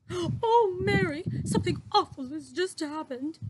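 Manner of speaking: shaken, crying out to the maid; upset after a bad dream; distressed. Queen wakes distressed from nightmare.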